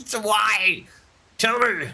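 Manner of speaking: mock whine. Still wailing at the game.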